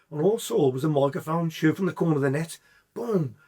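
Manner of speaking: usual speaking voice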